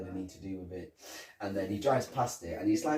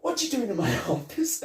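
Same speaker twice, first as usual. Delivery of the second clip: high, put-on shouty boss voice; mock indignant boss yelling; angry boss; 12 semitones higher, 4 dB louder than usual. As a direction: bark it like an outraged chief. Retelling a film scene, angry boss.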